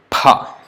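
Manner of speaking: "Pa" with lips closed, no f sound; teacher models a crisp unaspirated "Pa"; correct Sanskrit pa. Shown as a demonstration. Teacher demonstrating Sanskrit pa to students, crisp and unaspirated. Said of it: "It has to be pronounced as pa".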